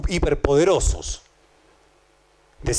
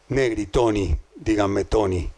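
mock Toni Negri; 7 semitones lower, slower than usual.

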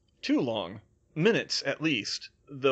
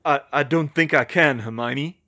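raised voice, hesitant start; calling back; 7 dB louder than usual. A narrator's reading. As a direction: call back haltingly, a little louder. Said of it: "he called back".

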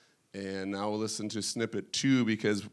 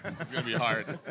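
laughing; 5 semitones higher, 4 dB quieter than usual. Real, not acted. Laughing at the awkward intro.

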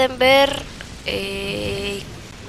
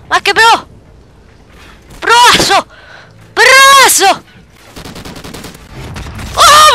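high, loud triumphant yells; yelling praise at his own play; hyped screaming; 9 semitones higher, 8 dB louder, slower than usual. Real, not acted. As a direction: scream with full gamer hype. Screaming hype during fight.

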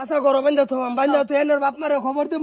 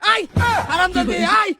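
angry yell; 4 dB louder than usual. Acted. Father yelling.

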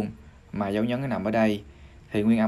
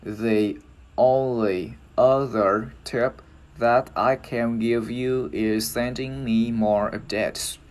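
slow, careful English demonstration; teacher slowly piecing the sentence together; joined-up model sentence; much slower than usual. Shown as a demonstration. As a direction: draw each word out for learners to follow. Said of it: "mình sẽ nói vào".

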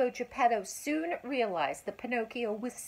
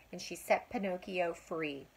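ordinary delivery, nothing marked

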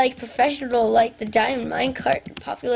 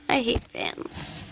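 muttered whisper; 4 semitones lower, 7 dB quieter, slower than usual. Real, not acted. Grumbling about the game fans.

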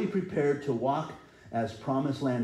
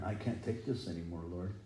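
retold despair; 5 semitones lower, 8 dB quieter than usual. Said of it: "I've been there crying and weeping".

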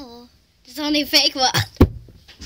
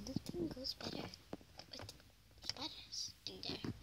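whisper; 21 dB quieter than usual. Real, not acted. Hushed aside about hidden spider.

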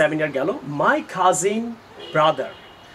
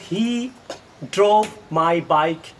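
slow wrong example; much slower than usual. Shown as a demonstration. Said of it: "এখানে তো কোনো ভুল থাকার কথা না".

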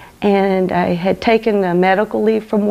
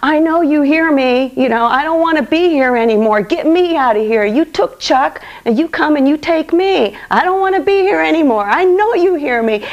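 retold yelling at God; 6 semitones higher than usual. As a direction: cry out in despair, demanding an answer. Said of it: "I would just yell things like".

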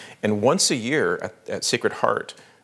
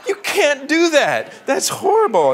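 outraged protest; 7 dB louder than usual. Retold or imitated. Wife scolding the author for killing Dorothy, angry. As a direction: protest hotly, like a reader betrayed. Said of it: "She was so mad".

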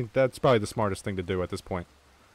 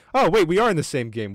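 higher and louder, sudden relief; excited realization; 6 semitones higher, 5 dB louder than usual. Real, not acted. Streamer to friend, realizing they share a game, excited. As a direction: burst out as it finally clicks.

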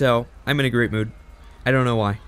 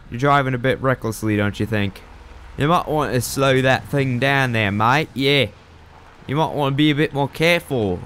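put-on amateur Australian accent, slightly raised voice; attempted Australian accent; 3 semitones higher than usual. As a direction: put on a mock Aussie accent to scold a driver. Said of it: "that's my attempt at a, uh, Australian accent".

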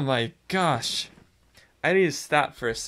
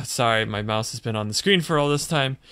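ordinary talk, nothing marked

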